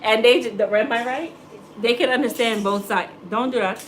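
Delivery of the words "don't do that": normal talking voice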